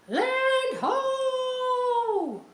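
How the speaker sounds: high ringing call of land ho; lookout's shout. Acted. Lookout calls out to the crew spotting land, high and ringing. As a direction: sing it out from the masthead.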